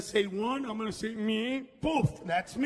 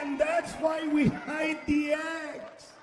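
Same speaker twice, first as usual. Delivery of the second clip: higher, slow, shouted; punchline shout; 4 semitones higher than usual. Real, not acted. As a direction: yell it out to the whole crowd.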